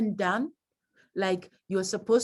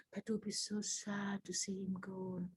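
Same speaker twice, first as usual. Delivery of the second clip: much softer, hushed and sad; soft sad aside; 2 semitones lower, 16 dB quieter than usual. Shown as a demonstration. Teacher showing a sad line in a eulogy, hushed. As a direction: drop it right down to a sad hush. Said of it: "You're saying".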